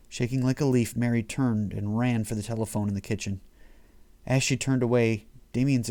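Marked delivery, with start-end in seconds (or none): none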